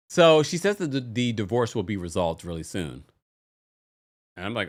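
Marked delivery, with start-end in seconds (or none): none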